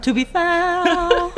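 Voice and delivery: high, slow, laughing mock posh English; mock British. Co-host echoing in a hammed-up British voice. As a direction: stretch it out high like a caricature Brit. Echoes in exaggerated voice.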